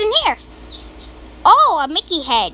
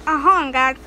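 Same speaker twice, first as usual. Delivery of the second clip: normal talking voice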